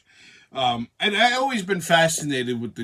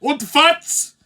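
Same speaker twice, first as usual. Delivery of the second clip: loud, high mock-German yelling; German-accented yell; 12 semitones higher, 7 dB louder, much slower than usual. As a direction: yell it like a comic German in a beer garden.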